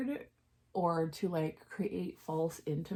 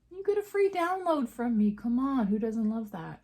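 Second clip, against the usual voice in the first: much higher and louder sales hype; excited pitch; 5 semitones higher, 6 dB louder than usual. Real, not acted. Promoting her free download to viewers, in an excited pitch. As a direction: sell the freebie with a big bright push.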